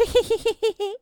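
high loud gleeful cackle; triumphant cackle. A narrator's reading. Anansi laughs over the red pot, gleeful loud cackle. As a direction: laugh like the trickster who won. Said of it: "Anansi looked into the pot and laughed".

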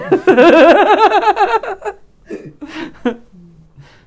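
loud high cackle; high laughing fit. Real, not acted. Laughing at the acted reaction.

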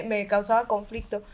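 ordinary talk

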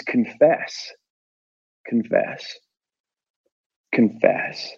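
slow, then drop to a whisper; fading to a whisper. Acted. Poet to church audience, fading whisper. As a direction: let each confess fade into a hush.

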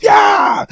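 triumphant yell. Acted. Triumphant cry.

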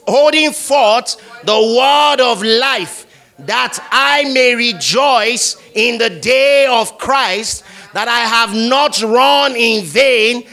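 high, loud, slow recitation; loud group reading. Shown as a demonstration. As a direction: carry the congregation through the verse. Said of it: "Everybody want to go?".

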